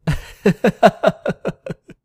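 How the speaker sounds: loud high cackle; creepy laugh. Acted. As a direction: cackle at your own dirty joke. Man laughs at own joke.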